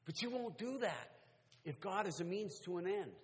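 impassioned preacher gathering force; preaching building to climax. Real, not acted. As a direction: drive upward toward a triumphant shout.

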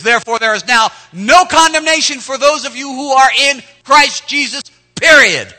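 high, loud, ringing proclamation; emphatic shout. Shown as a demonstration. Preacher booming the verse to the church. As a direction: proclaim it with full force. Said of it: "It says".

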